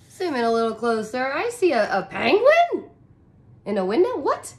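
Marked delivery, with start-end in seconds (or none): 1.3-4.5 much higher, louder surprise; surprised. Real, not acted. Reader spotting a penguin in the picture, amazed. 4.8-4.6 sharp excited intake of breath; surprised gasp. Real, not acted. Reader gasping at the picture for the class.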